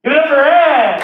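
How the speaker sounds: loud high emcee call; host announcement. Real, not acted. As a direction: bring the crowd in for the next act. Host introduces next comic.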